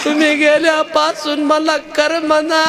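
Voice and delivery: high, slow mock-lamenting wife; fawning wife. Wife sees the gold and fakes longing, high and slow. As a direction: whine fondly like a wife who missed him.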